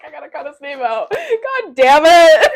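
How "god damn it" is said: loud, slow laughing groan. Real, not acted. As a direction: laugh out the curse. Laughing at own slip.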